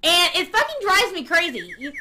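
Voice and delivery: usual speaking voice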